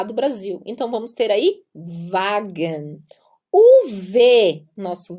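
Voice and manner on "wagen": whispered "Wagen" with a V sound; German W as V. Shown as a demonstration. Teacher demoing German W, whispered. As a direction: breathe "Wagen" starting with V. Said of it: "W se pronuncia V".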